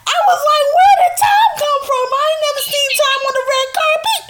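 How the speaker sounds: very high, loud comic squeal; mock-outraged high-pitched retelling; hammed-up disbelief. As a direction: shriek your baffled reaction for laughs. Retells own reaction, laughing along.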